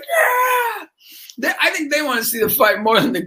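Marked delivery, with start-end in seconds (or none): 0.0-0.9 cheering teachers. Retold or imitated. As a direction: egg on the fight from the back. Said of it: "They're in the back like".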